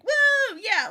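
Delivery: loud very high whoop; gleeful crowd yell; mock cheer. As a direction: cheer for the new deputy. Cats cheer new deputy.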